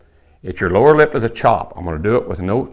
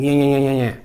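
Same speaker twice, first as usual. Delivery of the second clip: chase-away call; 4 semitones higher than usual. Shown as a demonstration. Teacher showing hunters how to chase off a small bull, imitated call. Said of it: "do a little chase away, I call it".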